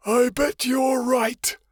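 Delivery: high, very slow menacing monster voice; sinister voice. Acted. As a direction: drawl it like a gloating beast. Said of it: "a sinister voice said".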